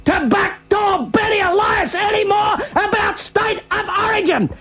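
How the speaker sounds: high, slow, hammed-up pleading cry; mock pleading. Radio host begging Backdoor Benny to stop talking, high hammed-up plea. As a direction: beg him in an overblown high wail.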